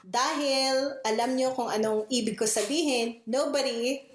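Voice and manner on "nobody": ordinary talk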